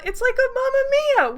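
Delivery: high, loud burst of delight; sudden gleeful discovery; excited squeal. Real, not acted. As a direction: squeal it out as it clicks. Realizes the game copies Mamma Mia.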